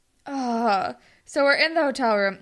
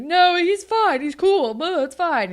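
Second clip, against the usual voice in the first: retold loud insistent defending; defensive; 8 semitones higher, 8 dB louder, slower than usual. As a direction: brush it off loudly, higher-pitched, imitating denial. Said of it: "He's, like, defending the coach".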